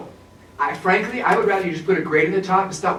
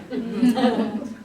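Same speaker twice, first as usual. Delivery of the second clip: short laugh; 6 semitones higher than usual. Real, not acted. Laughs at own joke.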